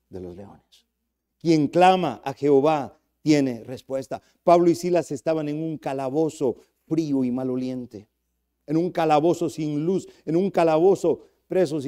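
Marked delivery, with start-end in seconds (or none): none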